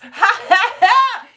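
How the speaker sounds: high, loud laugh; delighted cackle. Real, not acted. Teacher tickled by the name Gus, loud high laugh.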